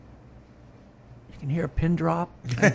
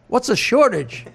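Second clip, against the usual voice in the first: American guy; 4 semitones higher, slower than usual. Retold or imitated. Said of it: "And the American says".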